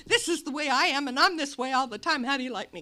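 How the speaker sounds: loud, fast tipsy boast; brash party-girl bragging; drunken swagger. Retold or imitated. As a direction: show off with a bottle in hand. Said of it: "I had this big magnum of champagne".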